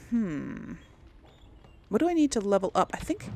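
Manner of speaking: ordinary talk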